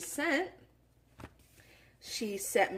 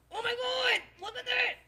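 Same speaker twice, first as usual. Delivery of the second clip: high squeal; 13 semitones higher than usual. Real, not acted. Squeal over gift.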